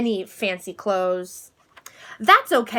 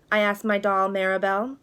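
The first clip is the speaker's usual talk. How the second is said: ordinary delivery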